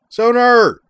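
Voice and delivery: long, high yelled call. A narrator's reading. Azalin turns back and calls to Zoner, yelling. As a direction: holler across the distance. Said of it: "turned around and yelled".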